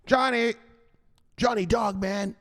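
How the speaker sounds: high mocking call of "Johnny"; buddy calling. Buddy calling Johnny, high mocking voice. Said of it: "Here's your buddy right here".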